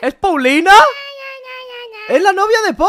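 high, loud, amazed cry; asombro exclamado. Pretend. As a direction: shout the astonished discovery. Reconoce asombrado a Paulina. (gritando de asombro)

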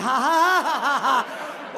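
loud, spaced fake ha-ha-ha; mock laugh.

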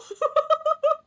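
high loud cackling laugh; shrieking laugh. Real, not acted. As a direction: burst into a shrill cackle. Burst of laughter.

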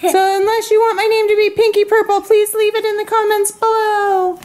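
high, squeaky-pitched talking unicorn; unicorn toy voice. Pretend.

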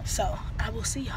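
soft whispered greeting; whispering. Real, not acted. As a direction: whisper it quietly.

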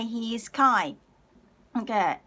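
normal talking voice

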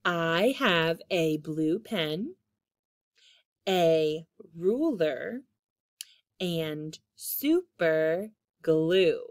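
slow, careful dictation; slow deliberate read-aloud. Shown as a demonstration. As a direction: read each word out slowly for kids. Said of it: "reading test sentence slowly".

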